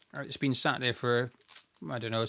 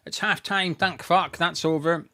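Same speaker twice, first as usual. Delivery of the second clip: relieved outburst; 4 semitones higher, 6 dB louder than usual. Real, not acted. Relief at half time.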